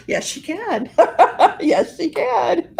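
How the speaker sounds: high voice bubbling with laughter; laughing tease. Real, not acted. Friend siding with the daughter against mom, laughing tease. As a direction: tease her between real laughs.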